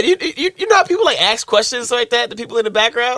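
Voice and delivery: high, loud, laughing; excited. Real, not acted. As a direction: rush it out cheerfully.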